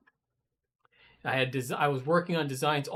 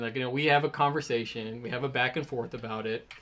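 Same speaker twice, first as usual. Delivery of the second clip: ordinary talk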